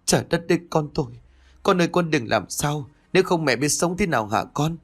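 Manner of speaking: mother's anguished wail, pitched high. A narrator's reading. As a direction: cry out for her hurt child. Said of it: "ôm mặt rồi gào lớn". (gào khóc thảm thiết, giọng cao vút)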